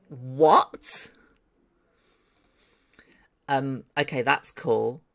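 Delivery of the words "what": loud, drawn-out rising what; can't believe what you are seeing; incredulous burst, stretched out; stunned disbelief. Real, not acted. Amazed at the render result.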